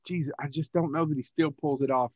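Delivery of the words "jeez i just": hushed, unvoiced aside; whisper. Real, not acted. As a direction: whisper it like a secret doubt.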